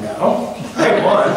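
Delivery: teen son protesting to his father, mock defiant; indignant protest. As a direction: insist you won, voice jumping up. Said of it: "And I'm like".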